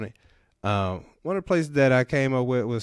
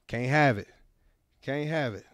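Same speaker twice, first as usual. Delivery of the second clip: usual speaking voice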